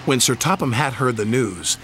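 ordinary delivery, nothing marked

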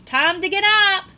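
high, loud parent call; mock wake-up call. Child mimicking parent calling out time to get up. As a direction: belt it like a parent waking the house.

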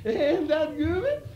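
laughing through a high coy line. Acted. Woman teasing her man, laughing. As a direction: giggle the words out.

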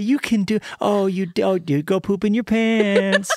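put-on high, delighted sing-song praise for a small child; hammed-up teacher cheering on every little thing; gushing kindergarten teacher. Host plays a kindergarten teacher praising little pupils, gushing high. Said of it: "What do kindergarten teachers do?".

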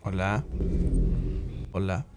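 quiet, low hushed hello; hushed greeting. Real, not acted. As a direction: murmur it so the enemy won't hear.

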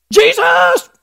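high-pitched, excited cry of recognition; mock overjoyed cry. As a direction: imitate her squealing with joy. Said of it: "be so happy and, and overjoyed".

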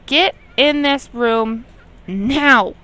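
slow, loud, stern order spelled out word by word; angry command. Real, not acted. Ordering the Sim child into the room, slow angry command. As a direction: lay down the law, slowly. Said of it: "I'm getting angry".

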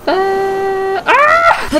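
loud, high drawn-out yell; mock-pained high scream. Reaction to game mishap.

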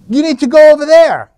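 loud, high call to the captain; insistent re-enacted yell; shouted instruction. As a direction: holler it across a distance. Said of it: "I'm telling the captain".

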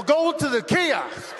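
slow, drawn-out insistent order; curt dismissal. Acted. Airport agent cutting off a customer's question, insistent. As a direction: cut them off, stretching each word.

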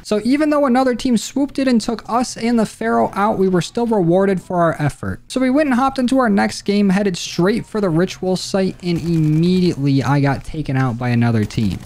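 recap narration. A narrator's reading. Recap narration over gameplay.

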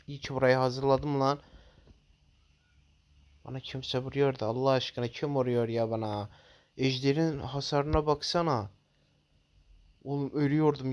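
ordinary delivery

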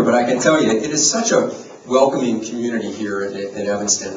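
usual speaking voice

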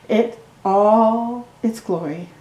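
ordinary delivery, nothing marked

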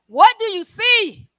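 high, loud, drawn-out question; calling out. Acted. Prophet asking his servant at the seventh time, calling out. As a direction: call it out slowly at full voice.

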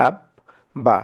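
"আব, বা" in two parts, the b closing and then opening; split syllables. Shown as a demonstration. Teacher showing the b said twice, "আব, বা" split by a pause. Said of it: "বকে আমরা দুইবার উচ্চারণ করেছি".

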